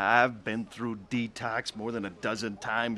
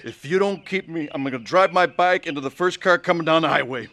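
desperate threat; 6 semitones higher, 7 dB louder than usual. Acted. Drunk man threatens desperately.

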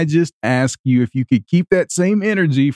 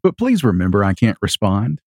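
mock serious voice; 7 semitones lower than usual. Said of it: "can you keep that voice up for long".

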